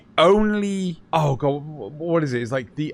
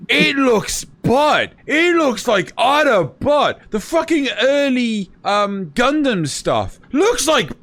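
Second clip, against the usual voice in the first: disgusted rant; 9 semitones higher than usual. Real, not acted. Ranting about ugly mecha design.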